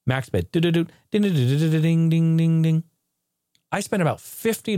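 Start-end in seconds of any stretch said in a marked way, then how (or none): 0.5-2.8 sung slot machine ding-ding noises; slot machine jingle. Retold or imitated. Vlogger mimicking a slot machine, singsong dings.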